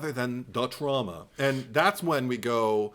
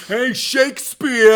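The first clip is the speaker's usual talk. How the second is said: much higher, louder cartoon Brooklyn accent, taunting; mock Brooklyn tough; 9 semitones higher, 11 dB louder, slower than usual. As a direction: sneer it like a Brooklyn street tough. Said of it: "saying lines like that".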